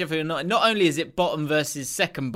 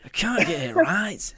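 Yorkshire grumble. Pretend. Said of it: "And he goes".

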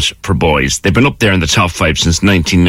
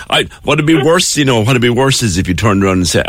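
ordinary delivery

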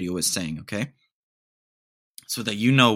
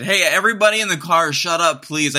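loud, fast, higher hey to the car; 6 semitones higher, 6 dB louder than usual. Retold or imitated. Telling friends in the back seat to shut up, loud call. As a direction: hush the whole car, urgently.

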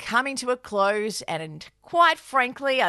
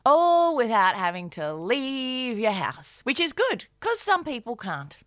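ad read; 4 semitones lower than usual. Acted. BetterHelp ad read by both.

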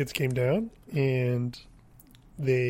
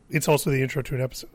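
ordinary talk, nothing marked

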